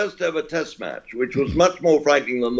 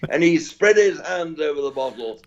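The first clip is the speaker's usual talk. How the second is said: ordinary talk, nothing marked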